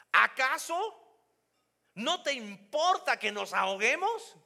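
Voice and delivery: "Acaso" drawn out very slowly, high and loud; accuse, don't ask; reproachful complaint. Shown as a demonstration. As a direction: disciples blaming the sleeping Jesus. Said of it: "¿Te das cuenta de la diferencia".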